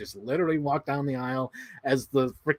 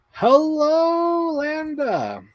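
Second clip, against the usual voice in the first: high, long-held sing-song hello; calling a greeting; 13 semitones higher, 3 dB louder, much slower than usual. Real, not acted. Streamer greeting a viewer who joins, high drawn-out call. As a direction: stretch the greeting out high, then drop.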